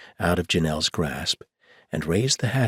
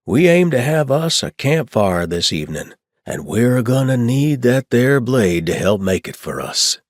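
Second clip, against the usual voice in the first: put-on Old West accent; 7 semitones higher, 8 dB louder than usual. A narrator's reading. Chuck handing Carmelita the hatchet, mock-serious Old West accent. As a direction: play the grave cowboy lawman. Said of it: "with mock seriousness".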